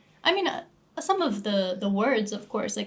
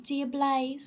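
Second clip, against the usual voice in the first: mock British accent, higher pitch; put-on British accent for a flatmate; British flatmate impression; 4 semitones higher than usual. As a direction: imitate a British girl, amused. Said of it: "you're good at impressions".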